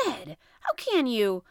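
slow, loud, appalled protest; shocked exclamation. A narrator's reading. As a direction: exclaim it as if scandalised by a friend. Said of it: "exclaimed Conseil".

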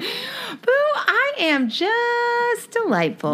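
high, drawn-out mock sweetness; hammed-up delighted coo; playful gushing. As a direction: stretch it out high and cutesy. Playful answer to pet-name greeting.

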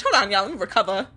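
normal talking voice

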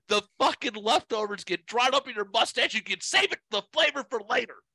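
high-pitched sarcastic rant, all in one breath; mock a furious driver, pitch up and barely pausing; Kelly Loper yelling. Imitating a driver ridiculing another motorist's goatee, mock yelling rant. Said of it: "'cause he's yelling".

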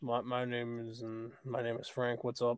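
low, put-on deep voice; forcing a deep voice. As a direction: drop the voice deep and gruff. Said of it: "put on a deep voice".

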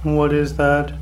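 usual speaking voice

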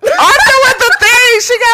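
high, slow, loud repeat; mocking shout. Real, not acted. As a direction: shout it out slowly, teasing. Echoing the phrase loudly.

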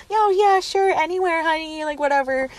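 very high-pitched fawning attendant; gushing. Retold or imitated. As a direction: coo to a favorite customer in a high mock voice. Attendant fawning over regular, retold.

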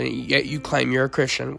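ordinary talk

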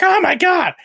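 high, loud mock shriek of shock. Woman caught in the restroom, high shocked yell. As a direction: imitate a startled woman crying out. Startled woman in retold story.

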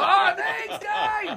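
high-pitched retold holler of thanks; mock long-range thank-you; calling back. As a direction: call gratitude across a distance. Calls thanks back across street.

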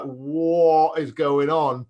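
baffled. Retold or imitated. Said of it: "people are just like".

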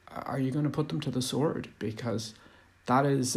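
ordinary delivery

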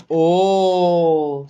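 long, loud storybook "oh" of wonder; marvelling, stretched-out exclamation; amazed. A narrator's reading. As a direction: draw out a loud gasp of amazement. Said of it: "si meravigliò la capretta".

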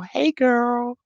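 put-on, stretched-out "girl"; playful drawn-out greeting. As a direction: repeat the hello playfully, drawing out "girl". Playful repeat of greeting to viewer.

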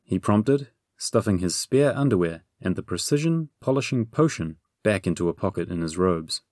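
usual speaking voice

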